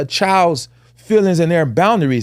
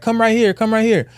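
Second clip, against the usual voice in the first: put-on higher coaxing call to the child; coaxing aunt; 6 semitones higher than usual. Aunt coaxing her shy niece over, pitched up and coaxing. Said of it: "And she like".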